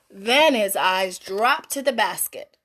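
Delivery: ordinary delivery, nothing marked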